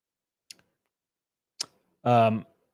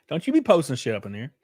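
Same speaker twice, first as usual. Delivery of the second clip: ordinary talk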